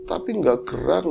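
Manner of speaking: ordinary delivery, nothing marked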